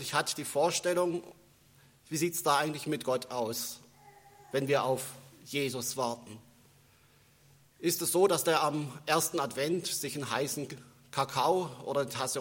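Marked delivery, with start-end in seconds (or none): none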